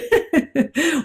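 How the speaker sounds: bright giggle breaking out; burst of giggling. Real, not acted. Teacher giggling at her own lesson, bubbly laugh. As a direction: giggle as if you can't help it. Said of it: "Here I go".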